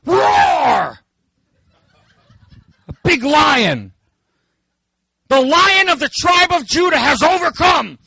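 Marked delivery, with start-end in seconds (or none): 0.1-1.0 big high roaring shout; hammed-up lion roar. Preacher roars as the lion. 5.3-8.0 high, loud ringing declaration; preacher proclaiming the Lion of Judah; triumphant proclamation. Acted. Proclaims the lion's victory.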